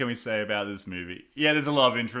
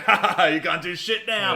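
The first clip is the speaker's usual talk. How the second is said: gloating Whitaker; 6 semitones higher, 5 dB louder than usual. Retold or imitated. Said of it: "He's like".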